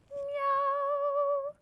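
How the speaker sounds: very high meow; high cat meow. Acted. Teacher recalling the kitty exercise, high meow. Recap of meowing.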